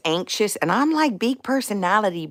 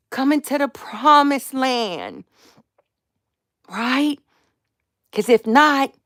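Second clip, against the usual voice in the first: imitating her mercy prayer; 7 semitones higher, 3 dB louder than usual. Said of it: "And I'm like".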